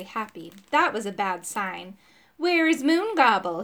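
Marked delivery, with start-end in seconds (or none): 2.4-3.6 demanding. A narrator's reading. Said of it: "he demanded".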